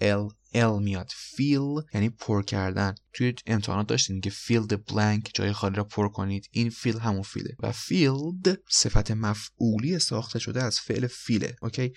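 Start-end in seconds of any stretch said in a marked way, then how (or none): none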